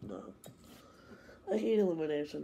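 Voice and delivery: usual speaking voice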